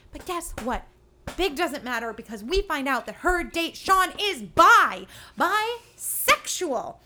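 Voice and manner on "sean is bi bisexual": dramatic reveal. Real, not acted.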